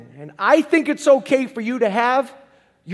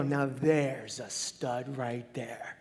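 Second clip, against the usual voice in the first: slow breathy whisper of praise; hushed admiring whisper; 8 semitones lower, 10 dB quieter, much slower than usual. Pretend. A stranger whispering praise, slow and hushed. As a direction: lean in and whisper it, impressed.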